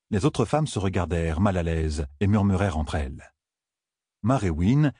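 ordinary delivery

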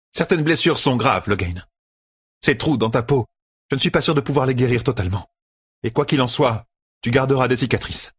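high woman's healer voice; concerned, stern sister. A narrator's reading.

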